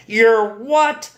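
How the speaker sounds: high, indignant outburst; incredulous, angry retort; outraged disbelief. A narrator's reading. Uncle outraged at boyfriend's refusal.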